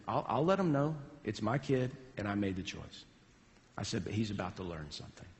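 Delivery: low, soft and measured; quiet resolve. Retold or imitated. Dad owning the choice to head coach, quiet resolve. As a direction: drop the voice, letting the decision stand.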